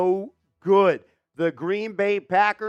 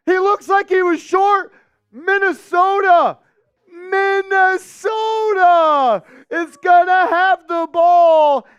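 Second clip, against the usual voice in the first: yelling the play as it happens, much higher and louder; excited shouting call; 9 semitones higher, 8 dB louder than usual. Real, not acted. Streamer calling the defensive stop, excited shout. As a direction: belt out the stop like a radio call. Live call of failed fourth down.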